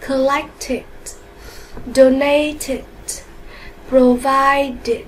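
"Collected", "Donated", "Provided" slowly ending in an extra "it" syllable; -ed read as "it". Shown as a demonstration. Teacher shows pupils the E D ending, "Collected", "Donated", "Provided" ending in "it". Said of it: "Thêm E D chúng ta đọc là it".

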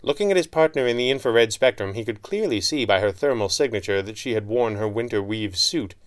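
ordinary delivery, nothing marked

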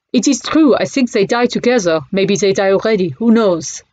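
put-on light French accent, mournful; broken French English. Cafe owner tells Bertie of the lion, light French accent. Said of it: "in broken English".

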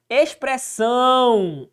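drawn-out Expressão, stressing the last syllable; teacher spelling out Expressão for the class; slow stressed word. Shown as a demonstration. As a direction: stretch Expressão slowly, leaning on são. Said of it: "Expressão, ah, terminada em ão".

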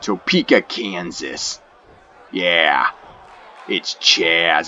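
very low, gruff wrestler promo voice; deep trash-talking wrestler. Acted. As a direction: cut a cocky heel promo. Speaker voices wrestler Chaz.

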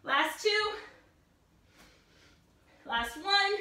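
usual speaking voice

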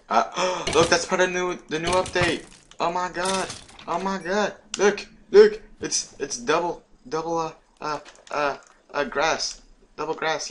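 high, thrilled gushing after a gasp; high-pitched awe, stumbling over words; excited discovery. Real, not acted. Discovers new update plants.

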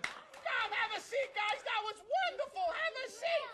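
TV host hype. Acted. Show host hypes the guests.

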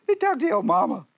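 ordinary delivery

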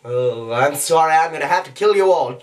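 cartoon villain threat, rising to a cry; mock apology turning murderous; Stewie Griffin. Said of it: "back over to Stewie Griffin".

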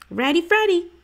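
put-on high, chirpy kid reply; eager boy. A narrator's reading. Little brother answering his sister, high and chirpy. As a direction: pop it out like a keen little brother. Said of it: "said Stink".

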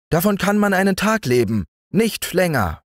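high, fast ant voice; brisk ant. A narrator's reading. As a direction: rattle it off as a know-it-all ant. Ant arguing.

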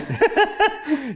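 high laugh, breaking up; real burst of laughter. Real, not acted. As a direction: crack up at the memory. Laughing at the strange room.

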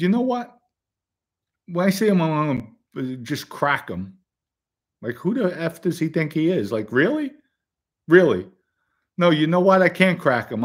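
high, heated outburst in retelling; upset, abusive rant. Retold or imitated. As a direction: act out working yourself up, scornful. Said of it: "Because it got me upset".

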